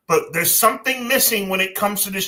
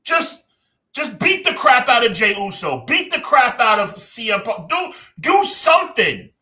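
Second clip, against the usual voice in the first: pleading urgently; 7 semitones higher than usual. Real, not acted. Urging Cody to change.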